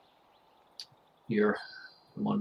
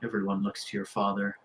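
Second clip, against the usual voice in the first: ordinary talk, nothing marked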